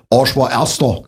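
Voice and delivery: "Arsch war Erster" with dark Saxon O vowels; Saxon pronunciation. Shown as a demonstration. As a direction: say "Arsch war Erster" as "Orsch wor Erschtor".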